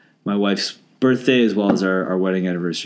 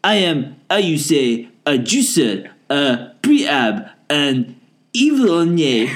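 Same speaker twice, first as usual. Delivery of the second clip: hammed-up fake French accent, higher and slow; 3 semitones higher, slower than usual. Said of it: "please read my emails with the accent".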